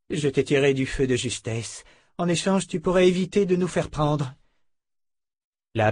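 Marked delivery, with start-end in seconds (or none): 0.1-4.3 higher, exasperated woman; irritated rescuer. A narrator's reading. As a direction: scold the clumsy girl. Blonde reproaches Beata. (la blonde reproche à Beata, agacée et plus aiguë)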